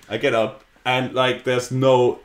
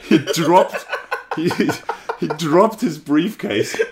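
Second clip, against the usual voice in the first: laughing; 7 semitones higher, slower than usual. Real, not acted. Excited retelling of man falling.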